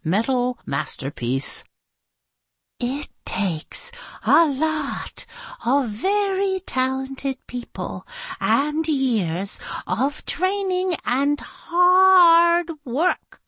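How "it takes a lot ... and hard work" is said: slow, high, drawn-out awe; reverent explaining. Acted. Teacher explaining paperclip making to children, reverent and slow. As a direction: stretch the words with grand importance.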